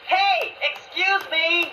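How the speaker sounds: calling out. Acted. Narrator calling up to the creature under the falls, loud and drawn out. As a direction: call out to get the creature's attention.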